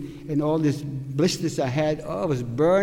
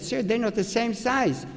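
higher-pitched mild protest; retold polite protest; 7 semitones higher than usual. Prisoner objects to the man handing out clogs, mild retold protest.

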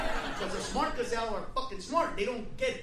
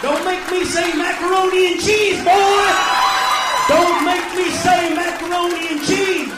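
loud, slow shouting; mock threat yell; 4 semitones higher, 12 dB louder than usual. Real, not acted. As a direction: bellow it like an angry parent. Comic threatening the crowd.

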